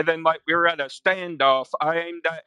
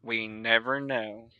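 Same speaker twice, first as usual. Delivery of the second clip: mock mysterious; 4 semitones lower, 8 dB quieter than usual. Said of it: "mock mystery".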